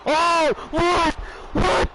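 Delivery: can't believe the kick-out; shocked disbelief. Real, not acted. Commentator stunned as wrestler kicks out, surprised.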